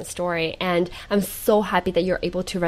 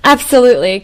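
absolutely, louder and higher, rising; emphatic gushing absolutely; 4 semitones higher, 11 dB louder than usual. Real, not acted. Host moved by the guest's story, gushing loudly. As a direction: swell with feeling on the repeat.

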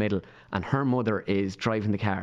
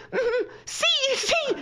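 very high, squeaky agreement; imitated eager confirming; much slower than usual. His mother confirms the name from the car, imitated high and eager. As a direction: agree insistently, pitched way up.

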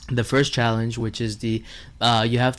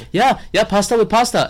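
nervous high agreement; 9 semitones higher, 5 dB louder than usual. Retold or imitated. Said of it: "I'm like".

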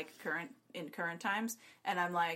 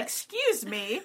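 mock offended; 3 semitones higher, slower than usual. Voicing offended Allies.

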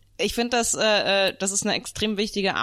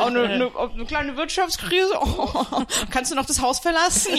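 mock teasing; 6 semitones higher than usual. Mock teasing about crisis.

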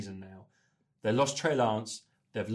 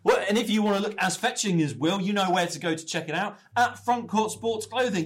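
higher-pitched, punchy podcast ad read; sponsor plug; 7 semitones higher, 5 dB louder than usual. Acted. Host plugging the clothing sponsor to listeners, upbeat ad read. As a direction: sell the sponsor's shop with energy.

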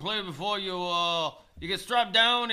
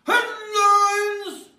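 high, louder Headlines! belted as a title; announced headline; 13 semitones higher, 6 dB louder than usual. Acted. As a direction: proclaim Headlines! like a show title.

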